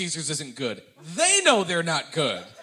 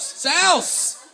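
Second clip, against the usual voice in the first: Sal's called out higher and louder; excited shout; 10 semitones higher, 5 dB louder than usual. Real, not acted. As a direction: cheer the name Sal's. Crowd names a pizza place.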